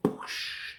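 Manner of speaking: big airy whoosh of a throw; imitated whoosh. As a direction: mimic someone hurled through glass.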